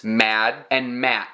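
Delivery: "mad and mat", slow, with the last D and T barely said; unreleased final plosive. Shown as a demonstration. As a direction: hold back the final stop in "mad and mat". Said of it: "I'm almost saying this plosive at the end".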